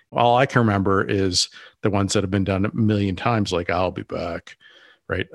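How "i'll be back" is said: ordinary delivery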